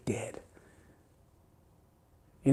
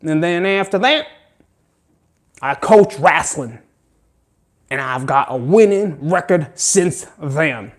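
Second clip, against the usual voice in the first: put-on fast, higher country accent bragging, slowing at the end; louder country accent coach voice; 5 semitones higher, 5 dB louder than usual. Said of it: "Monmouth County has a country accent".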